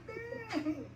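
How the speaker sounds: mock dismay. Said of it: "I'm like".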